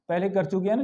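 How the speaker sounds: ordinary talk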